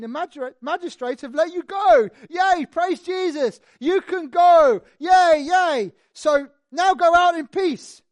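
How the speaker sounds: loud, high, slow cheering jailer; excited jailer. Acted. Jailer tells Paul he's free, cheering loudly. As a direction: whoop it up like a freed man's jailer.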